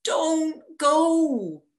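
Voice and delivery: put-on high, loud child begging; high boy's plea; child pleading. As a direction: plead like a scared boy. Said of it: "says my son".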